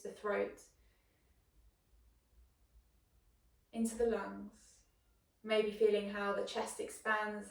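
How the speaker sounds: guided meditation. Acted. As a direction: guide the breath quietly, leaving space after each phrase. Leads a breathing meditation.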